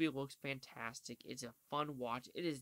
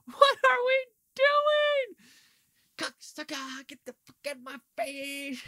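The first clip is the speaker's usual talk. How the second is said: screaming, much higher and louder, slow; Nicolas Cage freakout; 13 semitones higher, 16 dB louder, slower than usual. Retold or imitated. Reviewer imitating Cage screaming in a movie, full shriek. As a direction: go full unhinged shriek into someone's face. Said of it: "I'm doing the whole Nicolas Cage right there".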